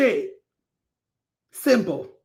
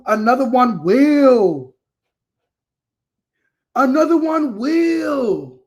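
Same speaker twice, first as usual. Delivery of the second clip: loud, drawn-out holler; emphatic proclamation; 4 semitones higher, 5 dB louder, slower than usual. Real, not acted. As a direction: stretch it out and belt it with conviction. Emphatic repeated catchphrase to viewers.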